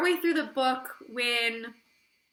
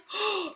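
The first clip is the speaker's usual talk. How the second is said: gasp; 10 semitones higher than usual. Retold or imitated. Reacting to shocking plot twist.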